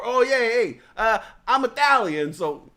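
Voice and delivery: loud, high, hammed-up host boasting; mock Italian host. Host explaining the Italian dinner, loud mock boast. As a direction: announce your heritage with gusto.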